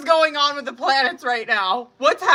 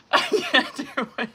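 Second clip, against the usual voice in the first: breaking into a laugh on the words; 2 semitones higher than usual. Real, not acted. To her viewers about the kitchen mess, laughing. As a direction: laugh it out, giving up. Overwhelmed by a turd on the floor.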